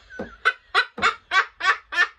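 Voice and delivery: loud, high cackling laugh; real laughter. Real, not acted. Storyteller recalls the Scotsman dodging traffic, bursting out laughing. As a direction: crack up helplessly at your own story.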